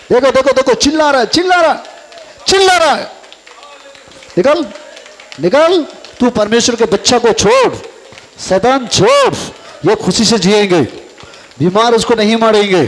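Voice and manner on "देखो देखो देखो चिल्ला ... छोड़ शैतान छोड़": climbing higher each command; fervent deliverance command; rising commanding rebuke. Real, not acted. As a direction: order the demon out. Casting out demon.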